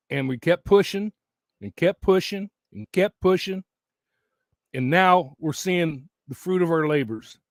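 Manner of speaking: ordinary delivery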